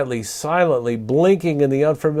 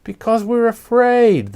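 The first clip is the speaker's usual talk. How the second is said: afraid chorus; 11 semitones higher, 3 dB louder than usual. Acted. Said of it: "answered in chorus".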